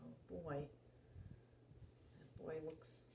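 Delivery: plain reading voice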